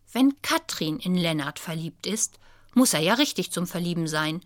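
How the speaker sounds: ordinary delivery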